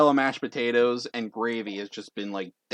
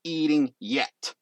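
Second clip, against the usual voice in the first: syllable by syllable; 4 semitones higher than usual. Acted. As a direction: lay down the rule one word at a time. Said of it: "with each syllable".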